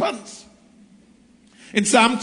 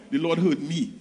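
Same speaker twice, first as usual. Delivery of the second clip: softer, hushed, almost to himself; soft awed statement; 6 semitones lower, 6 dB quieter than usual. Acted. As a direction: let it land quietly, in awe. Repeated line, first softly.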